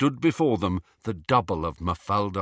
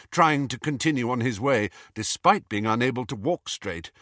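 usual speaking voice

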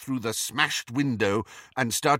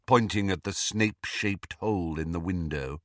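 usual speaking voice

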